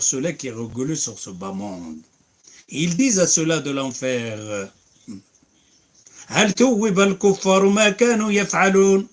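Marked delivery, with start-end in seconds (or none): 6.3-9.1 fast, raised chanted recitation of the verses; louder melodic tajwid recitation; Quran recitation. Acted. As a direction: recite like the imam, quick and melodic.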